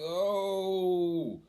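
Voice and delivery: high drawn-out reaction; retold horror. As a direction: draw it out in mock disgust. Disgusted at afterbirth.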